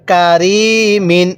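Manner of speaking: "কারিমিন" with the "রি" stretched out, very slowly; long middle syllable. Shown as a demonstration. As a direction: hold the "রি" of "কারিমিন", not the first syllable. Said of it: "রয়ের মধ্যে টান দিব".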